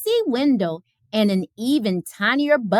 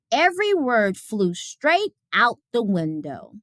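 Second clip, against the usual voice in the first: usual speaking voice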